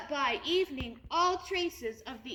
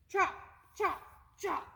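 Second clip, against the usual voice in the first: chopping sound; 6 semitones higher than usual. Acted. Chopping noise.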